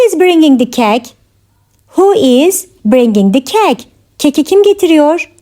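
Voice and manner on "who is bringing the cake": measured language-lesson delivery; careful teaching repeat. Shown as a demonstration. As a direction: pause after "Who is". Teacher repeats question for learners.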